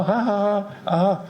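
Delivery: high imitated ha-ha laugh; acted-out helpless laughing; mock laughter. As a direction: laugh it out in high, spoken ha-has. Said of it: "I was laughing".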